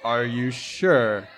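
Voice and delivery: low, slow creature voice, softened; Basuk. Acted. Companion doubts the crying friend is fine, low slow voice. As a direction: draw it out low, checking on a hurt friend. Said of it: "Basuk walks over to you".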